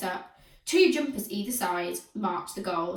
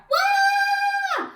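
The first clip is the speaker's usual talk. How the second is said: put-on long piercing child's wail; bawling cry, very high and loud; 7 dB louder than usual. A narrator's reading. Weepy pupil cries in class, wailing. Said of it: "wailed Weepy William".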